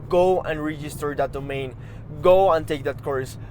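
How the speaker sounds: usual speaking voice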